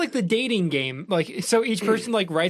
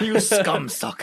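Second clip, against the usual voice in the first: slow mock-villain insult; berating gatekeeper; slower than usual. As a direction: echo the gatekeeper's verbal abuse in a drawn-out put-on voice. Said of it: "he's just berating you".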